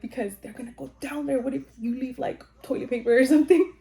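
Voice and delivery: high-pitched imitation of a fretting husband; worried husband. Husband warning wife before her exam, worried.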